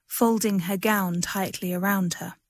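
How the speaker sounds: usual speaking voice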